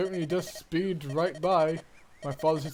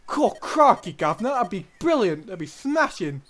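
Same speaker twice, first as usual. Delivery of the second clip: hammed-up Cockney, higher and louder; over the top Cockney; 4 semitones higher, 6 dB louder than usual. [name] gushes back to Roy in a hammed-up Cockney accent. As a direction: lay the Cockney on thick, brilliant and smashing. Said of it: "over the top Cockney British".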